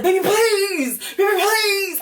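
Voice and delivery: very high, loud mock pleading; hammed-up begging wail, stretching "please"; Dwayne impression. Imitating a man begging his bride at the wedding, pleading. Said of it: "I don't got that kind of voice".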